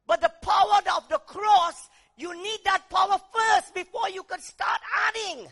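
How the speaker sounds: loud, driving sermon cry; fiery preaching. Acted. Preacher shouting to the congregation, loud and driving. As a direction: hammer the power of the cross home.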